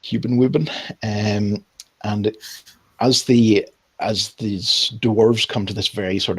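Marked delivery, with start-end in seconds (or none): 0.0-0.7 "Human woman" as "hyooben wooben"; playful mispronunciation. Retold or imitated. Narrator owning the slip, "woman" as "wooben".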